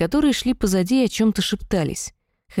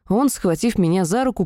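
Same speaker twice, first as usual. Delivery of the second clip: ordinary delivery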